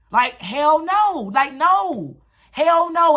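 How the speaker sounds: high, loud emphatic refusal; real upset. Real, not acted. Refusing her mother's question, really upset, loud and high. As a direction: slam the door on the idea. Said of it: "I'm getting upset all over again".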